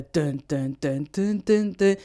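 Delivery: vocalised beat stepping up in pitch; rhythmic spoken riff; imitated intro riff. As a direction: mimic the song's intro with even duns. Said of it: "listening to the intro alone".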